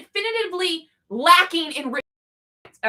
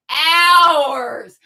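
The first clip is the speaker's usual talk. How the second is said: OURS drawn out long, lower and loud; insistent emphasis; 4 semitones lower, 4 dB louder than usual. Real, not acted. Ranter insisting to charities whose money it is, emphatic OURS held long. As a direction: stretch the word "ours" in indignant insistence. Repeats the word insisting on ownership.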